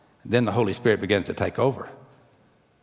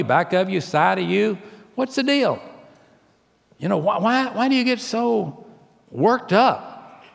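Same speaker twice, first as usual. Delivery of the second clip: preaching at full force; 8 semitones higher than usual. Acted. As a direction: preach it hard, rising and pounding. Preaching about road rage.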